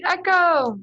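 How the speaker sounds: called out echo; cheerful yell of "Echo!"; playful shout. Shown as a demonstration. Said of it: "that's them screaming".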